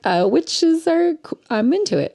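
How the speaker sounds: high mock Ellen, intrigued. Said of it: "She's like".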